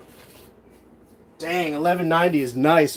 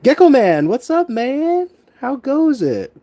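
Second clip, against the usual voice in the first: excited greeting; 10 semitones higher than usual. Real, not acted. Greeting a viewer in chat.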